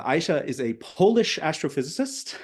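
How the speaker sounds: ordinary delivery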